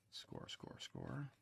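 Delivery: softly chanting along while working; absent-minded murmur. Real, not acted. Talking along while scoring clay.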